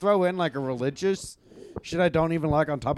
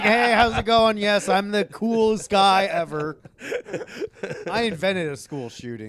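cool guy boast; 5 semitones higher than usual. Pretend. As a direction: play the coolest guy ever. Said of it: "It's like".

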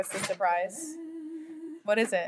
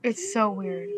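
ordinary talk, nothing marked

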